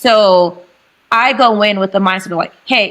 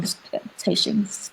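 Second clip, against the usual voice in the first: very soft, whispery aside; hushed aside; 12 dB quieter than usual. Real, not acted. Guest qualifying her own wording, in a hushed aside. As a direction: drop right down for a side note.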